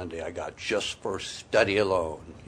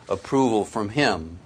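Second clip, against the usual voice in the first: ordinary delivery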